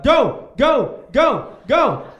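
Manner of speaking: loud hype-man chant, go go go; hype man cheering. Retold or imitated. Hype man yelling at a couple dancing, loud. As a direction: pump up a dancing couple, shouting. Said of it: "I was like".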